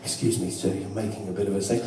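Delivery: very low, hushed staff voice; discreet polite request. Acted. Shop staff to a screaming customer, hushed and discreet. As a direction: quietly ask a customer to calm down.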